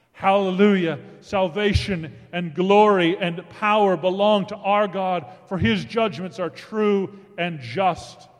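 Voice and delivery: fervent preacher proclaiming; preaching. Acted. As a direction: ring it out like a crowd in heaven. Multitude crying out in heaven.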